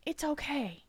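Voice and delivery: retold reassurance. Mother telling crying kiddo she understands, retold reassurance. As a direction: comfort a child who keeps apologizing.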